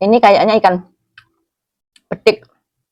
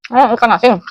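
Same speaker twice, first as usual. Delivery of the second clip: pleasantly surprised; 6 semitones higher, 6 dB louder than usual. Real, not acted. Discovers the salted fish.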